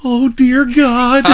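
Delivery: high, slow mocking plea; mock prayer. Wrestler imitating Billy praying, high slow mock plea. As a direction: draw out a pretend little prayer, pitched high. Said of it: "and it sounded like this".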